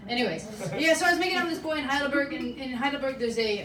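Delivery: ordinary talk, nothing marked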